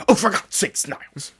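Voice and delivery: exasperated Frasier Crane cry of Niles; huffy sitcom-snob outburst, pitched high; Frasier Crane impression. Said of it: "not my Frasier Crane impression".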